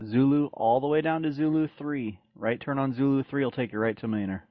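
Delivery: ordinary talk, nothing marked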